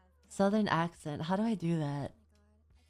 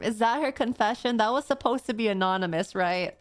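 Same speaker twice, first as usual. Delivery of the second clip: normal talking voice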